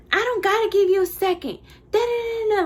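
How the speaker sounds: high, loud mock rant; going off. Customer going off at the worker, loud mock rant. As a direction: imitate an irate customer running her mouth. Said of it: "she just started going off".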